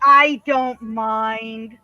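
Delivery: very slow, stretched-out reassurance; drawn out. Real, not acted. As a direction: stretch every word of I DON'T MIND. Cooing about the pet, then repeats plainly.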